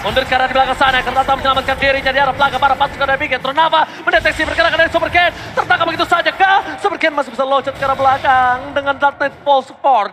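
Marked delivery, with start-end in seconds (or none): 0.0-8.8 fighting game announcer. Acted. Fast fight calling.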